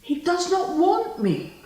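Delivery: anguished protest. Acted. Spurned lover lamenting that he does not want her, anguished. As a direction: cry out the hurt of rejection.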